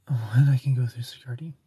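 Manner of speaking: breathy whisper to herself; whispered aside. Real, not acted. Aside muttered to herself on stream.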